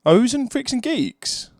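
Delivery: high, loud sudden realization; light up, you know him; surprised recognition. Real, not acted.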